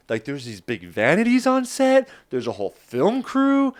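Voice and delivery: rising, high-pitched disbelief; retold amazement, pitch climbing high; amazed. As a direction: marvel at how big it all is. Amazed at size of film set.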